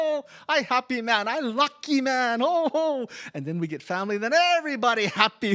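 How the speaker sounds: high, loud, laughing delight; joyful. Real, not acted. Newly married man rejoices, high and laughing. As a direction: burst with happiness. Joy after getting married.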